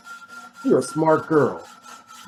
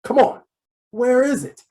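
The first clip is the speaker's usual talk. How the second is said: impatient demand; 10 semitones higher, 3 dB louder than usual. Acted. Gangster demands the missing key.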